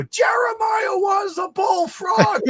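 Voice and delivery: high, drawn-out mock holler; top-of-lungs shout. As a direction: belt it out like a kid's outburst in church. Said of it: "at the top of my lungs".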